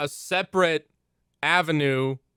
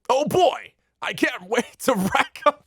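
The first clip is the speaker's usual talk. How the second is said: high, eager anime hero voice; overacted anime dub; 8 semitones higher than usual. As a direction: chirp it like a cheery anime lead. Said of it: "sounds like an anime character".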